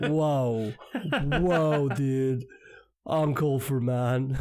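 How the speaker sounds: slow, drawn-out American dude impression; American accent. Joking co-host showing off an accent, mock American dude. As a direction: stretch the vowels in a put-on American accent. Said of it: "that was a pretty good American accent".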